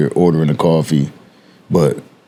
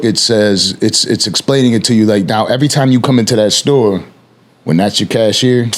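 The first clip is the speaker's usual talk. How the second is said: ordinary talk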